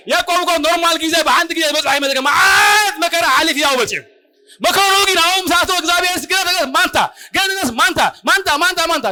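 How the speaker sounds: high-pitched, full-force preacher delivery; impassioned sermon, voice pitched high; fervent preaching. Real, not acted. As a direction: preach it hard, driving the repeated words home.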